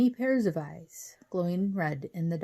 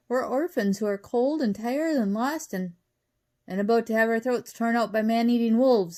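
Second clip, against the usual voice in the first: loud, high, building appeal; desperate plea; 5 semitones higher, 7 dB louder than usual. A narrator's reading. Wally begs shelter from man-eating wolves, desperate and loud. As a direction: plead louder and louder as danger closes in.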